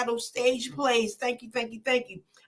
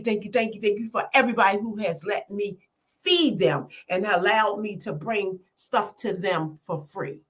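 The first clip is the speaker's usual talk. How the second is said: ordinary talk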